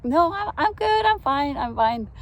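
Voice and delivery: squeaky embarrassed protest; flustered reply. Retold or imitated. Teary narrator waving off a stranger's hug, high flustered voice. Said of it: "And I'm like".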